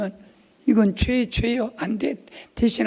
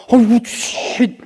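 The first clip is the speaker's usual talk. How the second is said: hammed-up shush; 5 semitones higher, 6 dB louder, slower than usual. Hushing wife so God won't hear.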